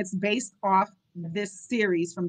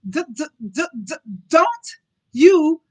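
put-on child's stutter; 7 semitones higher, 10 dB louder than usual. A narrator's reading. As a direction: fight through the D into a high burst. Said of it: "Simon attempted the sentence again".

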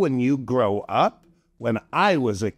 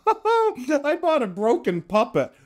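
laughing; 11 semitones higher than usual. Real, not acted. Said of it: "I cracked myself up".

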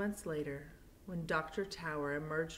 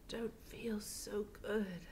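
put-on slow, faint whispered words of a sick old woman; weak voice; 3 semitones higher, 6 dB quieter, slower than usual. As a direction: barely get the words out, weak and feverish. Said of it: "she finally said in a weak voice".

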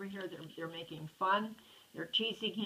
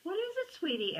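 caring mama; 11 semitones higher, 4 dB louder than usual. Acted. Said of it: "asked Mama".

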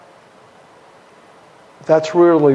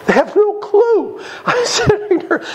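amused disbelief; 14 semitones higher than usual. Real, not acted. Preacher marvels at brothers' ignorance.

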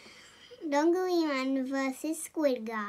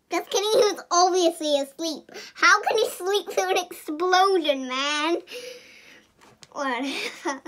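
usual speaking voice

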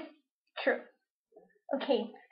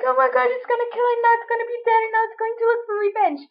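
high, fast frightened babble; panicky; 7 semitones higher, faster than usual. Retold or imitated. Watching mom about to swat the cricket, high fast panic. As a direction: rattle it off in a mock panic. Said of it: "I'm there all panicky".

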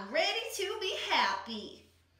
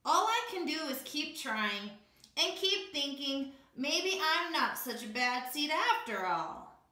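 ordinary delivery, nothing marked

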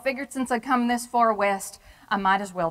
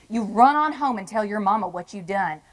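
ordinary talk

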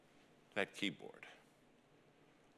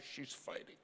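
She's fighting breathed out, unvoiced and soft; hushed echo; 9 dB quieter than usual. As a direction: let the words fade to a whisper. Doctor's words echoed, hushed.

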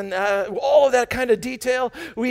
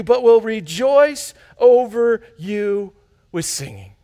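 slow emphasis; 3 dB louder, slower than usual. Shown as a demonstration. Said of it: "slowed scripture".

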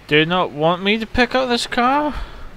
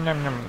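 "nom, nom, nom" repeated softer and lower, trailing off; quieter mock munching; 3 semitones lower, 6 dB quieter, faster than usual. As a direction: let the chomping fade out under your breath. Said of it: "that lawyer never makes that sound".

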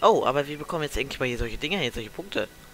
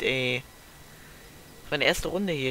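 normal talking voice

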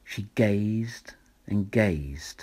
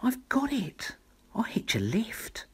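high excited whisper of a tiny snail; a tiny creature's breathy thrill; snail's cry; 12 semitones higher than usual. A narrator's reading. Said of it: "then cried".

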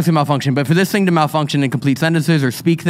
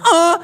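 high mock moan; a short, high put-on moan; imitated moan; 13 semitones higher than usual. Retelling what she just heard, imitated moan.

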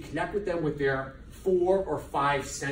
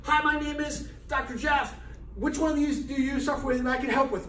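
higher, louder, inviting screening pitch; friendly booth pitch; 10 semitones higher, 3 dB louder than usual. Retold or imitated. Doctor Jeff inviting passersby at a screening booth, higher and friendly. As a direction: welcome a passerby to your stand. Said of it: "stand there in screenings and say".

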